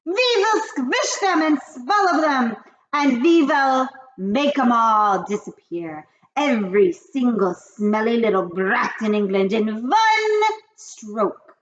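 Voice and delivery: high, loud witch rant. Acted. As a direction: rage like the Grand High Witch. Grand High Witch speech.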